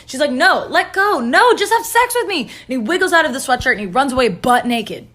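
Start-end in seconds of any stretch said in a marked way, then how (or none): 0.3-2.4 high, insistent back-and-forth; hammed-up tussle, pitched up and stretched; mock struggle. Potiphar's wife and Joseph fight over the sweatshirt, frantic.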